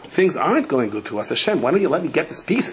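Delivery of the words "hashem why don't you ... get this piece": ordinary talk, nothing marked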